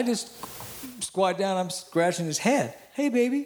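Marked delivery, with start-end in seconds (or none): 2.9-3.4 high sweet talk to a pet; baby talk to a dog. Retold or imitated. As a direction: coo at the puppy.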